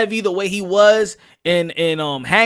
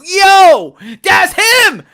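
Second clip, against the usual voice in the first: loud, high hyped shout; imitating an excited fan yelling; 11 semitones higher, 11 dB louder, slower than usual. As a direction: cheer him on from the stands. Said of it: "you'd be like".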